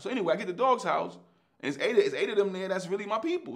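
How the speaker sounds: fast and high, rushing with excitement; can't get it out fast enough; excited. Real, not acted. Excited retelling reaching Dog's house.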